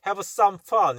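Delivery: "have some fun" run together without a gap; straight through. Shown as a demonstration. Teacher offering an acceptable way, "have some fun" said straight through. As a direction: say "have some fun" as one connected phrase. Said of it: "이렇게 발음해도 될 것 같은데".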